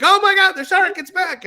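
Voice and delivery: high, loud hammed-up alarm; a skipper faking terror for the guests; mock panic. Ride skipper shouting to passengers, hammed-up high alarm. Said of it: "that's when we're like".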